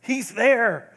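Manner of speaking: high, loud, joyful recognition; overjoyed, seeing someone beloved; dying mother glimpsing Jesus, thrilled; excited awe. Retold or imitated. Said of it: "she was so excited".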